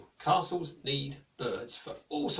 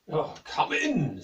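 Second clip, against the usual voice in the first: wizard calling; 6 dB louder, slower than usual. Acted. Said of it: "said the wizard".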